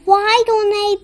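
usual speaking voice

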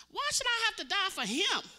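high, loud replay of her own cry; retold outburst. Nurse recalling her protest to God, high and loud. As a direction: throw the words out again. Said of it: "I let those words come out of my mouth".

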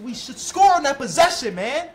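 1 loud complaint. Real, not acted. Repeats complaint, louder first.

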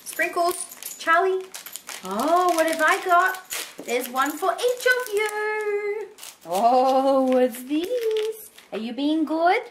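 high, sing-song pet voice for the dogs. Real, not acted. Owner calling her two dogs over for treats, cooing baby talk. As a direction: coo at the dogs like babies.

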